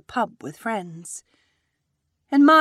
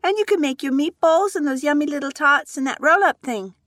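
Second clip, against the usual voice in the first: put-on eager child voice; 9 semitones higher, 6 dB louder than usual. Young daughter listing food.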